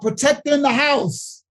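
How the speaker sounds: ordinary delivery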